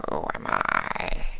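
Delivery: puzzled creature sensing love; slow wondering changeling. A narrator's reading. Changeling echoes slowly.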